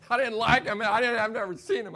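loud, high, fed-up protest; mock outburst. Brother blurting out he's fed up with Norm, loud.

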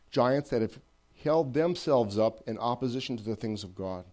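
ordinary talk

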